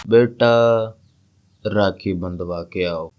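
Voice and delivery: very low, soft, slow order; father's voice. Acted. Father repeats his errand to son, deep and slow. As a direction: drop deep like a father quietly insisting. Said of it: "अपने बाबूजी की तरफ देखता हूँ".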